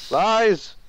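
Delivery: ordinary talk, nothing marked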